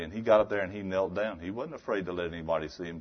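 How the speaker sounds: usual speaking voice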